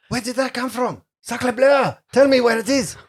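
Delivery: high, loud hammed-up French accent; over-the-top French cook voice; french chef. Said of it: "and said, Sacre bleu".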